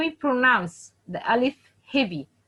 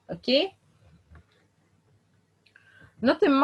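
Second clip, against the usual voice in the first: normal talking voice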